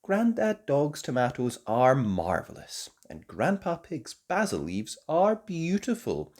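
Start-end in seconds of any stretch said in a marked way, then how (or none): none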